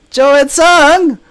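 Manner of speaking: high, loud, slow call of the name; a fangirl's drawn-out shout; starstruck shout. Retold or imitated. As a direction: cry out the name like a fan.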